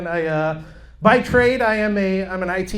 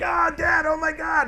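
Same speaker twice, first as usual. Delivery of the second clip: high yelping cry of pain, half mock; a kid wailing over a hurt finger; 10 semitones higher than usual. Kid yelps to dad about a smushed finger, high and pained.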